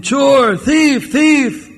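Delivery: shouting alarm. Acted. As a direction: call out that there's an intruder, pitched high. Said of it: "the person started shouting".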